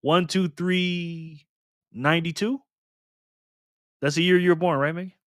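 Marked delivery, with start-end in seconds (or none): 0.0-2.6 slowly musing. Real, not acted. Musing over the number.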